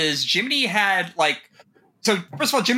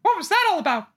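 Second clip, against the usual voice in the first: funny animal voice; much slower than usual. Acted. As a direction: squeak out the question, baffled. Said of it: "do a funny voice".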